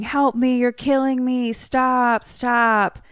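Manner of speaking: high, loud mock screams for help; retold screaming. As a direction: act out panicked yelling, pleading to stop. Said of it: "he's screaming".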